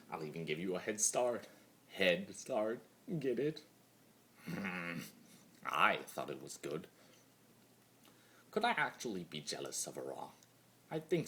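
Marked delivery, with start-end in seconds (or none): none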